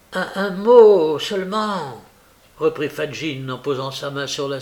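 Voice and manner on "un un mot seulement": slow, higher, stammered appeal; halting plea. A narrator's reading. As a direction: plead haltingly for a hearing.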